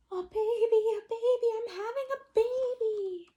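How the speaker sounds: high, loud shriek of news; excited yell. Acted. Mother waking the house, yelling high and loud. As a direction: shout it high and thrilled. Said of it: "Kristen's mother woke up and yelled".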